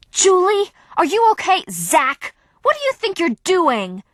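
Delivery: high, loud worried outburst; alarmed then accusing; upset and angry. A narrator's reading. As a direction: check on her friend, then round on him. Sam still furious after attack.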